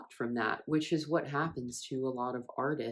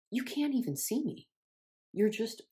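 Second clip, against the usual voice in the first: retold exasperation; 8 semitones higher than usual. Voices exasperated thought at grandmother.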